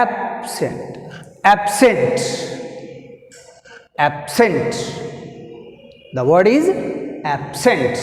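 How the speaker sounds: abSENT, weight on SENT; teacher drilling abSENT, stress on SENT; stress on second syllable. Shown as a demonstration. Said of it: "Second syllable is scent".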